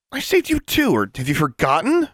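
high-pitched, tense comeback; sharp, heated reminder; hissed retort. A narrator's reading. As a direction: fire back with indignation. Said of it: "she hissed back".

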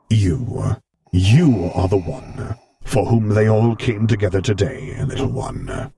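measured and grave, a touch amused; the Vader voice, held steady; Vader's voice. Acted. Vader's voice held across lines.

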